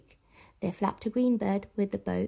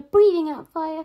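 ordinary delivery

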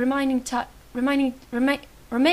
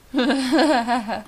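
short chuckling burst; laughing. Acted. Barnaby laughs at Pop's hug, short chuckle. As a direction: giggle at the awkward hug. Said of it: "Stop laughing".

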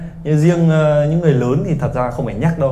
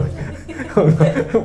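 amused laugh; 7 semitones higher than usual. Real, not acted. Laughing at the joke.

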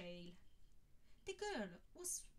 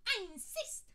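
delighted to oblige, voice soaring; eager admirer; 13 semitones higher, 7 dB louder than usual. A narrator's reading. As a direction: fan offering his place, high and eager. Man insisting.

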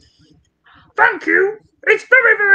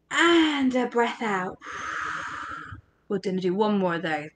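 guided breathing cool-down, softer; calm breathing guide; 2 semitones lower, 7 dB quieter than usual. Acted. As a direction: ease the kids down gently. Leads calming breathing.

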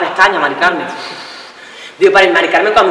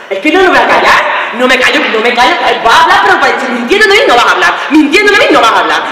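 heated refusal to be silenced; angry raised voice; 3 semitones higher, 4 dB louder than usual. Acted. Boyfriend refusing to shut up during an argument, angry raised voice. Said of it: "Sí me estás gritando".

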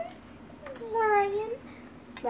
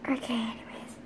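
whisper; 3 semitones lower, 11 dB quieter, faster than usual. Pretend. Aside after gasp.

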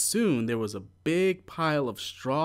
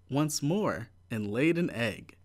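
ordinary delivery

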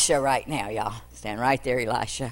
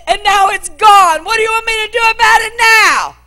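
much higher, louder anguished crying, slowing on the peaks; grieving outcry; 11 semitones higher, 10 dB louder than usual. Acted. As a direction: pour out the loss, voice breaking. Grieving mother confronts the prophet.

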